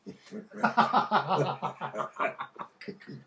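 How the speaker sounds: long high laugh. Real, not acted. After telling the judge story, a long hearty laugh. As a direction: cracks up at his own punchline.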